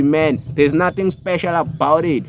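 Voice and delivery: ordinary delivery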